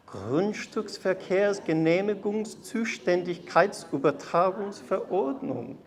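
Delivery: sweet melodious German; melodious tones. Shown as a demonstration. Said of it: "in our best pronunciation and sweetest melodious tones".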